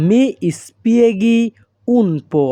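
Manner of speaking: very slow, every syllable laid out clearly; slow teaching demo. Shown as a demonstration. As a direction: spell the phrase out slowly for learners. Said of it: "তাহলে Italian ভাষা". (ধীরে ধীরে স্পষ্ট করে বলা)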